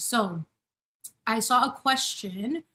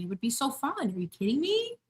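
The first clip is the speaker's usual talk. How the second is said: ordinary talk, nothing marked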